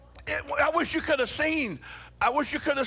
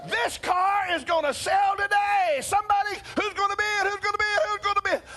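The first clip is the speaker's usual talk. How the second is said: auctioneer's call; 9 semitones higher than usual. Retold or imitated. Auctioneer pressing the crowd for a bidder. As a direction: call for a bidder over the room.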